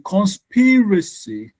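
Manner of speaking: conSPIracy, SPI high and stretched; stress on second syllable. Shown as a demonstration. Teacher quizzing class on conspiracy, stressed on SPI. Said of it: "the sp is what is to be emphasized".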